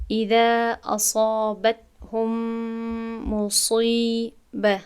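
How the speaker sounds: very slow اذا اصابتهم مصیبه, ص heavy; drawn out Quranic reading; careful heavy ص recitation. Shown as a demonstration. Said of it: "چطوری که گفتم".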